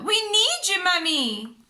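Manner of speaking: high, loud plea; crying out. A narrator's reading. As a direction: call Mummy for help. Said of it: "cried Peppa".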